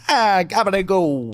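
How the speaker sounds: slow, drawn-out fake Italian accent; mock Italian. Mocking Americans asked to speak Italian, mock Italian. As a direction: stretch it into a hammed-up Italian accent. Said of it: "oh, go and speak Italian then".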